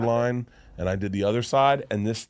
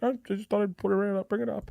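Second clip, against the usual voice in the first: regular brain; 9 semitones higher than usual. Retold or imitated. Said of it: "your little regular brain".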